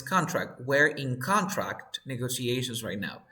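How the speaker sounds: CON-tract as in the noun contract; noun stress. Shown as a demonstration. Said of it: "the adjective or noun".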